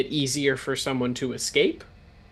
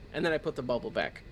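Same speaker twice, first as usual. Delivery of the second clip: usual speaking voice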